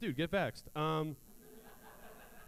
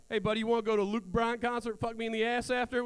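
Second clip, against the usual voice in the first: Southern guy voice; 6 semitones higher than usual. Acted. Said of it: "we need a Southern guy".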